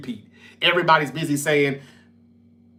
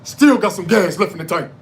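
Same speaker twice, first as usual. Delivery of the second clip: loud, hammed-up wrestler promo; Mark Henry; 6 dB louder than usual. Fan quoting Mark Henry's retirement promo, loud wrestler impression. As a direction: call it out like a wrestler on the mic. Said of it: "Remember the promo when, when Mark Henry".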